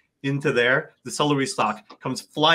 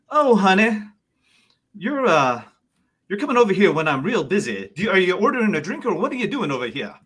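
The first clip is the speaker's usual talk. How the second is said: higher, swooping bartender voice; sassy busy bartender; 6 semitones higher, 3 dB louder than usual. Acted. Bartender to a customer interrupting busy work, sassy. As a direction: play the busy bartender calling someone honey.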